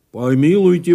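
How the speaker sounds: loud, scandalised protest; a fool's loud outburst; indignant exclamation. A narrator's reading. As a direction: exclaim it forcefully, as if objecting. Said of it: "воскликнул дурак".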